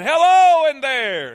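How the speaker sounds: high, loud, slow call; child shouting hello into a cave. Acted. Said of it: "he said".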